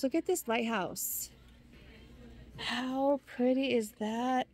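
normal talking voice

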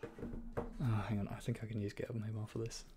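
ordinary talk, nothing marked

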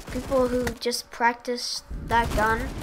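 ordinary delivery

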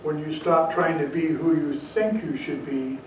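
usual speaking voice

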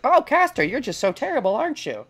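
high, singsong mock taunt. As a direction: tease her in a sneering high voice. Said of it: "people being like".

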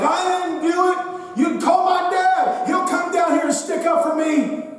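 put-on high, shouting teen denial; hammed-up indignant teenager; whiny kid protest. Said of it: "And I'm like".

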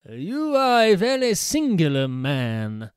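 put-on a puzzled old priest, weighing each word; priest's voice. Priest replies to the stranger.

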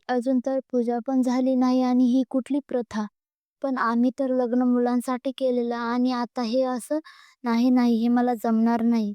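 plain reading voice

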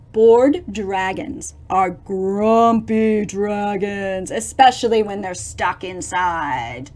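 slow fact. Acted. Fact read slow and low.

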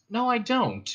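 high-pitched denial, falling at the end; protesting. Acted. Ford denies hating Marvin, high protest. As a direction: deny it with exaggerated insistence.

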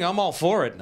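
normal talking voice

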